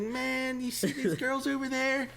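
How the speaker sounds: high sly voice; insinuating clerk. Retold or imitated. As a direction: nudge him knowingly. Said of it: "He's like".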